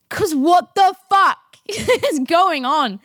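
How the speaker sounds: high, loud, drawn-out disbelief breaking into laughter; incredulous laughing outburst. Real, not acted. Friend retelling the breakfast howling fit, incredulous and laughing. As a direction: shout it slowly through a laugh.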